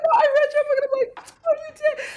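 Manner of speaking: very high squealed re-enactment; giddy surprise. Retold or imitated. Author hearing a reader read her book, mock giddy surprise. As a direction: replay the exchange in an amazed squeak.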